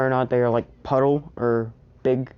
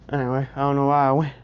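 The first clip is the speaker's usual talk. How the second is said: ordinary delivery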